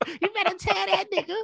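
very high, squealing egging-on voice; hyped-up listener. Acted. Imagined listener cheering on the podcast, high hyped-up voice. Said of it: "somebody listening to this shit like".

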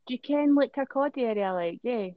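high-pitched imitated Fife singsong; mock Fife accent. Mimicking someone from the Kirkcaldy area, mock Fife accent. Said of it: "They do the singing thing also".

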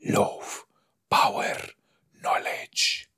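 hushed, tempting offer, word by word; mock demon whisper. Imitating the show's demon making his offer, whispered. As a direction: whisper each word apart.

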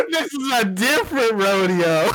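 laughing quip. Real, not acted. Co-host joking about returning there, laughing. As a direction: joke while cracking up.

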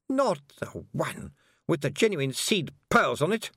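slow, high, strained and held in; tight little voice. A narrator's reading. Anagramma hearing the circlet was trodden on, tight and strained. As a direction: squeeze the dread into each word. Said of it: "in a tight little voice".

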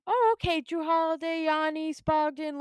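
high, loud start easing down; pleasantly impressed fan; retold delight. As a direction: perk up, then list the lineup approvingly. Retold delight at new lineup.

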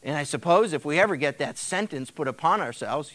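ordinary talk

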